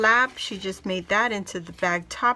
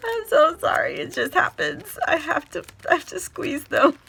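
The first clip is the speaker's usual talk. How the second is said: laughing apology; 7 semitones higher, 5 dB louder than usual. Real, not acted. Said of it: "I just do this every time I get puffy stickers".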